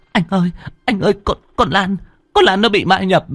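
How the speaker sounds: high, fast, stammering words; stammering in fear. Acted. Hằng stammers to Hùng that Lan is possessed. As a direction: blurt it out, tripping over words. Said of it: "mà lắp bắp".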